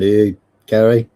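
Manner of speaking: ordinary delivery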